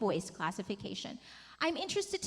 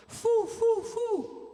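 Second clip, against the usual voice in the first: high hooting "foo"; owl-like head-voice hoots; hooty owl sound; 10 semitones higher, 4 dB louder, much slower than usual. Shown as a demonstration. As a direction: hoot like an owl. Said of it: "make it hooty like an owl".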